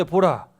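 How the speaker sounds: 보라 called out high and loud; emphatic proclamation. A narrator's reading. Teacher turning to a new story, loud emphatic 보라. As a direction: throw out 보라 to grab attention. Announcing the next scripture event.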